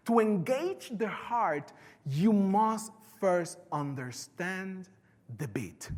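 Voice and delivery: slow, weighty quote fading down. Acted. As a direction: let each phrase land slowly. Quote read slowly for effect.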